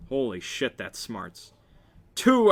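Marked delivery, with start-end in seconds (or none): 2.2-2.5 very high, loud screamed count. A narrator's reading. Counting the second blow as it smarts, screaming. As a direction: let it out as a scream. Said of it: "I scream".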